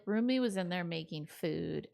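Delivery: normal talking voice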